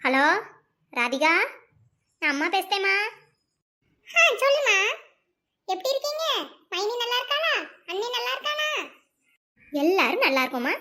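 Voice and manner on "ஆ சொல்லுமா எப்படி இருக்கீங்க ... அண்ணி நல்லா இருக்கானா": daughter on phone. Acted. Daughter answers mother on phone.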